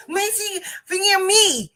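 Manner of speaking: high, garbled insistence; frustrated student imitation. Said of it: "she's yelling at me".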